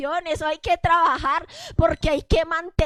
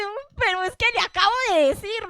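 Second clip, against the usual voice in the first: exasperated, higher-pitched imitation of a pastor; mock exasperation; 5 semitones higher than usual. Pastor reacting to someone who ignores advice, mock exasperated. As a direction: act out mild frustration at being ignored.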